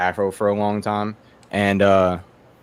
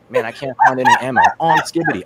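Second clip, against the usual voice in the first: put-on much higher, louder, fast squeaky kid voice; mocking kid; 6 dB louder than usual. Mocking the little cousin during Call of Duty, high squeal. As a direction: squeal it like an annoying eight-year-old. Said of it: "I was like".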